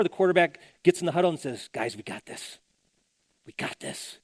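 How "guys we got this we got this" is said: calm reassurance. Retold or imitated. Calm quarterback reassures huddle.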